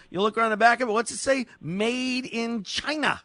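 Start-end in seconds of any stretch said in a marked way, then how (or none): 1.6-3.2 sarcastic reading. Retold or imitated. Reading label with disdain.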